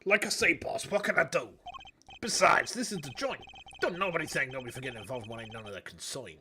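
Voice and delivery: gruff Bronx tough-guy con; thick Bronx accent. Acted. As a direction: lay on a heavy Bronx accent. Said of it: "such a thick Bronx accent".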